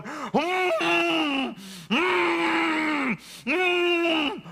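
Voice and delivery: muffled high whimpers through a mouthful; panicked closed-mouth cries; high panicked mumbling. Retold or imitated. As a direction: mumble in alarm with lips shut tight, high and strained. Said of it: "and I'm going".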